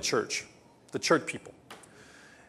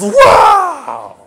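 high, loud wow of amazement; delighted shout; 14 dB louder than usual. Retold or imitated. Beggar at the king's table, shouting with wonder. Poor guests thrilled at feast.

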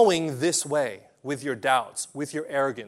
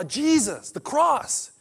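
usual speaking voice

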